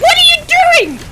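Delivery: screaming in disbelief, very high; excited shout. Real, not acted. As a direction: yell it at the screen. Caster reacts to team wipe.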